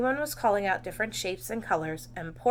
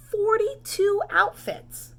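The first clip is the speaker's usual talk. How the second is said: dramatic emphasis; 12 semitones higher, 4 dB louder, much slower than usual. A narrator's reading. Exaggerated story emphasis.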